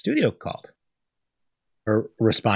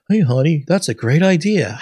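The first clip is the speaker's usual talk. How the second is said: louder, higher mock husband approving; 5 semitones higher, 6 dB louder than usual. Husband cheering his wife's billboard idea, in a mock voice. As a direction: ham up the clueless husband cheering her on. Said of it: "her husband going".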